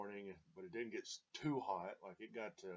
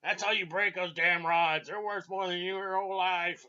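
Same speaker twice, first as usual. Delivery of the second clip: imitating an old captain yelling, much higher and louder; mock cussing captain; 9 semitones higher, 13 dB louder than usual. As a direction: bark it like a furious old sea captain. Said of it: "he was cussing at me".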